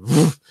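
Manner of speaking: loud, high "woof" sound effect; sudden puffed-out falling noise; imitated whoosh. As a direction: voice the thump of someone dropping through. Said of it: "just literally just went".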